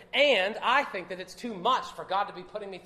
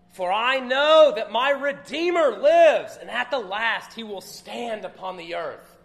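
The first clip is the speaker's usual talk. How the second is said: triumphant proclamation; 3 semitones higher, 13 dB louder than usual. Acted. Preacher declaring the famous Redeemer verse, loud and triumphant. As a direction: proclaim it to the whole church like a victory.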